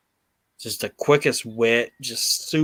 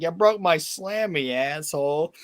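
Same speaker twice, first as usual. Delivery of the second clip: high wounded whine; hurt child voice, pitched up; heartbroken whine; 6 semitones higher than usual. Retold or imitated. Host imitating Owen Hart scolding Bulldog over the trophy, high hurt whine. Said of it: "And Owen's like".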